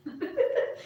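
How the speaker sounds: high breathless giggle; brief breathy laugh while teasing. Real, not acted. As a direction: let out a high airy titter.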